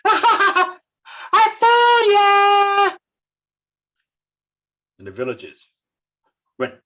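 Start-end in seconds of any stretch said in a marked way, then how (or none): 0.1-3.0 mocking laugh. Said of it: "laughing at them".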